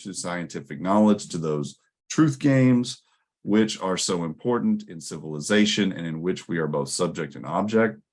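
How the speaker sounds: ordinary delivery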